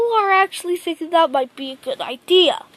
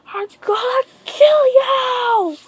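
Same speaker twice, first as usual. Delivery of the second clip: angry shout; 6 semitones higher, slower than usual. Acted. Danzo threatens Naruto.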